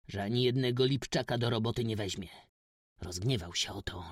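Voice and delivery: ordinary delivery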